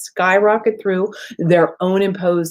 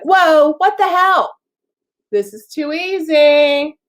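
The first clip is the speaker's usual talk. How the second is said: mock surprise; 7 semitones higher, 4 dB louder, slower than usual. Mock self-sabotaging client surprised.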